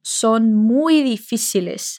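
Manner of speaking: Son muy difíciles spoken very slowly; slow model phrase. Shown as a demonstration. Teacher models the Spanish sentence slowly for students. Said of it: "Como você diz".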